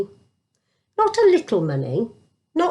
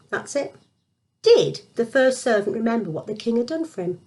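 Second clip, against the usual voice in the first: ordinary delivery, nothing marked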